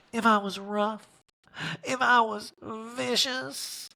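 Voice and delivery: a hammed-up tearful plea; mock tearful Erika. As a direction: ham up a weepy confession. Voicing Erika's tearful confession.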